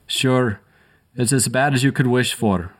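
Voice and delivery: a light Irish brogue, grim verdict; Irish hiss. A narrator's reading. Paramedic giving the bad news over the patient, light Irish brogue. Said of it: "hissed O'Grady".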